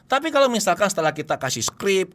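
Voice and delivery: ordinary talk, nothing marked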